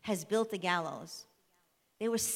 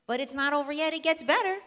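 excited build-up; 8 semitones higher, 7 dB louder than usual. Real, not acted. Teacher hyping the story's next part.

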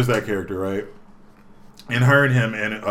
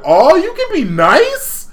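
much higher, slow, louder mock surprise; teasing girl; 9 dB louder, slower than usual. The girl catching Haseo being nice, much higher and slow. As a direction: drawl it out, teasing. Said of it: "She's like".

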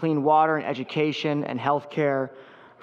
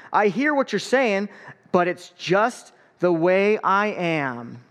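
put-on lively picture-book read-aloud for kids; story time reading; 3 semitones higher, slower than usual. A narrator's reading. Pastor reading a picture book to the congregation, lively read-aloud.

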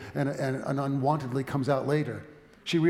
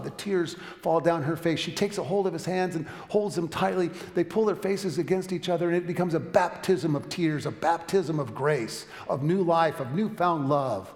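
loud, raised-pitch preacher's crescendo; impassioned preaching; 5 semitones higher, 6 dB louder than usual. Acted. Preacher telling of a tearful reconciliation, impassioned. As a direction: drive the phrases home, building to a peak.

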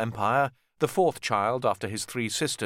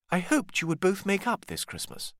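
lightly higher, gentle mother; Cecily; 8 semitones higher than usual. Acted. Mother Cecily hoping her sons reconcile, lightly voiced gentle. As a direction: ease the tension as the mother.

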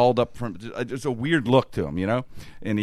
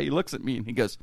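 usual speaking voice

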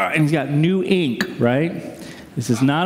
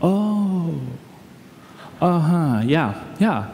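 awkward acknowledgement; much slower than usual. Retold or imitated. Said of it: "and we just went".

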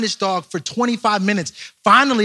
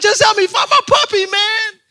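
high, loud pleading yell; hammed-up frantic demand for help; angry; 5 dB louder than usual. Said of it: "'cause I'm so angry".

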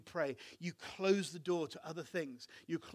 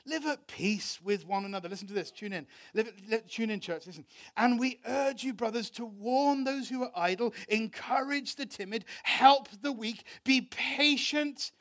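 preaching at full force; 5 semitones higher, 10 dB louder than usual. Acted. Pastor preaching Thessalonians to his church with fervour. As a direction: thunder the verses over the church.

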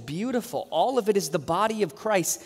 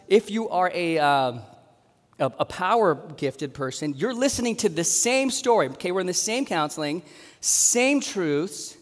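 ordinary talk

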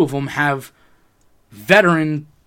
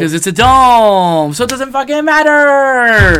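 loud; 9 semitones higher, 10 dB louder, slower than usual. Real, not acted. Heated sports argument.

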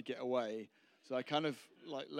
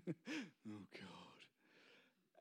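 soft, sinking, under the breath; ashamed groaning prayer; 2 semitones lower, 12 dB quieter than usual. Acted. Preacher acts out slinking into church riddled with shame, ashamed. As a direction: let it fade away in mock shame.

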